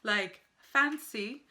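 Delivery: relishing British word "fancy"; slow stressed "fancy". Shown as a demonstration. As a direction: savor the word "fancy" slowly. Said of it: "they'll say".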